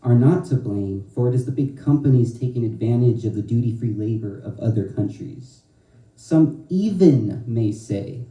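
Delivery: strong, rhythmic slam poetry delivery; spoken word poetry. Acted. Poet performing her poem to an audience, rhythmic and forceful.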